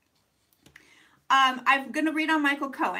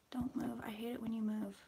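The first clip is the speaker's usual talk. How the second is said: whispering; 4 semitones lower, 13 dB quieter, faster than usual. Real, not acted. Muttering at camera mount.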